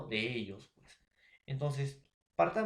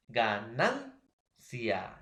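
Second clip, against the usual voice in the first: ganancia very slowly in syllables, NAN punched and rising; stress on penultimate syllable; 3 semitones lower, much slower than usual. Shown as a demonstration. Teacher showing students a grave word, penultimate syllable stressed. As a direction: break up ganancia as ga, NAN, cia, drawing out each. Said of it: "la mayor fuerza de voz en la penúltima".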